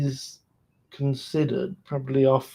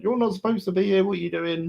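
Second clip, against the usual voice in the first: higher-pitched, hurried droid challenge; mock challenge; 6 semitones higher, faster than usual. Droid catches Echo where he shouldn't be, mock challenge. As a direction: question an intruder in a higher, quicker voice.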